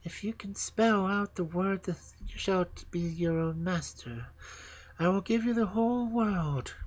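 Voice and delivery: lightly voiced cold queen; a hint of a regal queen; snow queen voice. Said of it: "The Snow Queen had said".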